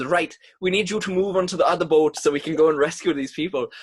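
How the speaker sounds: put-on fast, loud Welsh accent; brisk Welsh rescue officer. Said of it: "Welsh accent".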